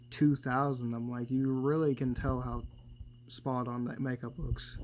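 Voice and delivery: usual speaking voice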